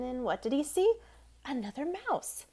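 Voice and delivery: plain reading voice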